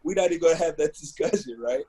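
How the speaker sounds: laughing through it. Retold or imitated. Brushing off the mentor's religion talk, laughing. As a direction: laugh your way through turning him down. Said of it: "And I was like".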